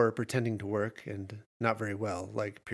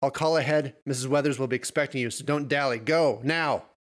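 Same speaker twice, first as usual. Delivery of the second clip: loud, raised-pitch telling-off; angry scolding; 5 semitones higher, 6 dB louder than usual. A narrator's reading. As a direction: scold the students and order them out. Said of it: "Aiden whined".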